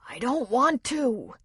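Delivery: slow, wheezing protest. Acted. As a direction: push the words out on a wheeze. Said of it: "Art wheezed".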